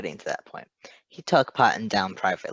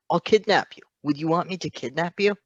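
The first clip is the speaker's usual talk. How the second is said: eager; 7 semitones higher, 5 dB louder than usual. Acted. Patton's eager offer.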